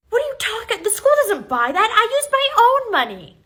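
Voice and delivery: high, loud indignation; outraged. Acted. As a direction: scold in rising outrage. Teacher outraged at snack theft.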